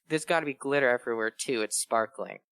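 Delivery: ordinary talk, nothing marked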